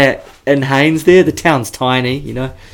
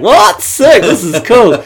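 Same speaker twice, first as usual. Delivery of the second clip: higher, slow and stretched out in disbelief; mock amazed; 9 semitones higher, 4 dB louder, slower than usual. Boys hearing the gun offer, mock amazed. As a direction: ham up the stunned delight. Retelling the boys' amazed reaction.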